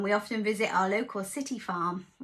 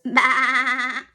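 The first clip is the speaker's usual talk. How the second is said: sheep bleat; 11 dB louder than usual. Shown as a demonstration. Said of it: "What makes a bah sound".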